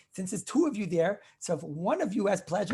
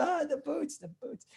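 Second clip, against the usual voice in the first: fond reminiscing; 7 semitones higher, 6 dB quieter than usual. Retold or imitated. Wife recalls the boots fondly.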